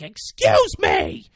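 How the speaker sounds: loud, drawn-out mock shout; imitated yelling. Bus driver being ignored, imitated yell. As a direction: demand his attention in a hammed-up yell. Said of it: "is yelling".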